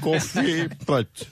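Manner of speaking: Confit pote said slowly, each syllable savoured; slow mock ad slogan; slow savoring. Shown as a demonstration. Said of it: "savours the slogan slowly".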